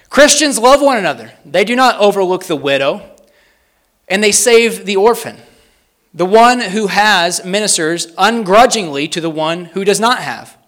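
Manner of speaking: high, loud, deliberate declaration; proclaimed quote reading. Real, not acted. Preacher reads a historian's words about Christians, loud and deliberate. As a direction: proclaim each phrase to the whole room. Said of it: "read these slowly and succinctly".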